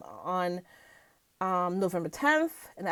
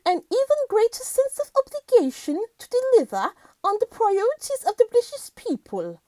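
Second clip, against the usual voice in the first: put-on very high, regal Queen-style British accent; 11 semitones higher, 3 dB louder than usual. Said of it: "trying to do this British accent".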